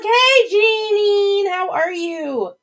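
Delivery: welcoming a viewer who just joined, long and high; drawn-out excited greeting. Real, not acted. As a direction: stretch the hello high and slow. Greeting a viewer joining the stream.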